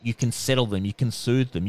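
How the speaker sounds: ordinary talk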